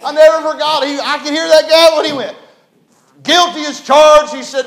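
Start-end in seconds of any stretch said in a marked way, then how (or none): 3.2-4.3 loud, high, slow judge's verdict; booming verdict. Retold or imitated. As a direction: hammer down each word. Magistrate after gavel.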